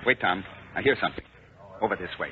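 hushed. Acted. Colonel hushes the cadet outside the enemy's door. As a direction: keep it down so nobody hears.

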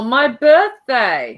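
loud, drawn-out mock announcement; imitating brother. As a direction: impersonate a brother proudly declaring it, stretched out. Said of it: "If you ask my brother, he'll say".

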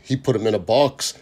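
ordinary delivery

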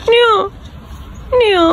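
mock crying. Mother pretends to cry to baby.

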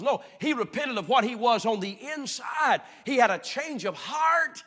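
loud, high emphatic shout; shouted sermon climax; preaching shout. Real, not acted. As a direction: proclaim it at full force.

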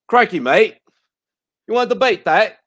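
put-on Aussie bloke ribbing you, in an Australian accent; playful Australian accent. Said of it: "They're like".

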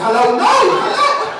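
high-pitched, drawn-out comic shout; exasperated phone yell. Acted. Retelling an exasperated phone pickup.

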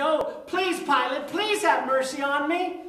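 high, pleading whimper of a beaten man; begging prisoner. Acted. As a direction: beg for mercy, voice up high. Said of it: "look up through beaten, tearful eyes and say".

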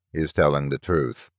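low, soft toneless box voice; lightly voiced toneless machine, low and soft. A narrator's reading. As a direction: state it like a talking metal box. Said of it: "the metal box said tonelessly".

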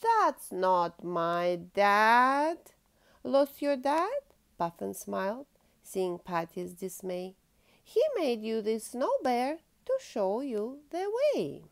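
lively storybook reading aloud; reading to children. Acted. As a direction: read it like a bedtime picture book. Reads picture book to child.

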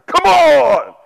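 loud high "Come on!" to the guy next door; eager shout. Retold or imitated. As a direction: egg yourself on with a yell. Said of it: "I'd say".

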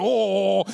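loud drawn-out oh; a teasing group oh; crowd oh. Retold or imitated. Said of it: "They're like".

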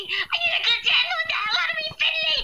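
high squeaky mock whine, laughing; silly high-pitched imitation of a friend; mocking whiny friend voice. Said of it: "Please stop the voices".

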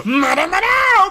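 high, loud hammed-up shout; playful full-volume shout; mock battle cry. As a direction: yell it like an over-the-top anime cry. Shouts along with determined character.

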